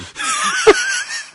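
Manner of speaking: high squealing laugh. Real, not acted. Narrator bursts out laughing.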